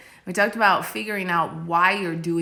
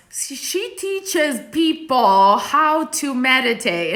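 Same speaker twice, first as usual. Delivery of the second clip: mom's voice, higher and louder, slow and gesturing; imitating Italian mom; 7 semitones higher, 6 dB louder, slower than usual. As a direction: play the Italian mom explaining her daughter's work to a friend, slowly. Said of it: "And she was like".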